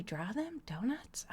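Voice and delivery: unvoiced, puzzled whisper; whispered question. Real, not acted. Streamer querying chat about donuts, whispered. Puzzled aside at chat's suggestion.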